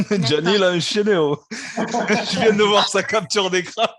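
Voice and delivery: laughing through the words, slow; cracking up while talking. Real, not acted. Host laughs at Gianni's screenshot, laughing.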